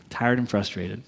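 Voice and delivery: low, subdued admission; weary, low answer to own question; deflated. Real, not acted. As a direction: drop the pitch way down, deflated. Deflated answer, then repeated.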